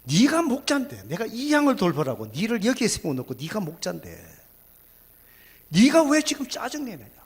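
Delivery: the Spirit scolding his shepherd; stern rebuke. Retold or imitated. The Holy Spirit sternly scolding the impatient pastor. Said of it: "성령님이 저를 막 책망하시더라고요".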